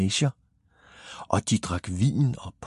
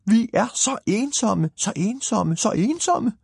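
high, chanted lament; crowd's wailing cry; 12 semitones higher than usual. A narrator's reading. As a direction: wail it high like a whole crowd complaining. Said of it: "alligevel råbte de alle sammen".

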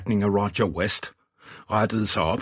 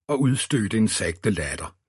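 ordinary delivery

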